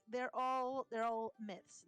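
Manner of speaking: usual speaking voice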